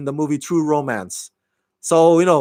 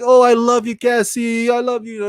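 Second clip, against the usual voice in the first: higher, gushing retold sweet talk; mock lovey-dovey boyfriend; 6 semitones higher than usual. Boyfriend sweet-talking his girlfriend, mock lovey-dovey. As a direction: imitate a smitten boyfriend laying it on. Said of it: "he'll be like".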